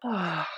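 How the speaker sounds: audible relaxing sigh; deep breath out. Retold or imitated. Teacher breathing out to settle a nervous student, relaxing sigh. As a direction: let out a long sighing breath. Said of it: "take a deep breath".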